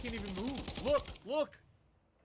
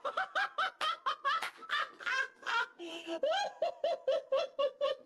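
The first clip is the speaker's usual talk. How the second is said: howling laugh. Real, not acted. Gamer cracking up at his own blunder, shrieking laugh. Explosive laugh after failure.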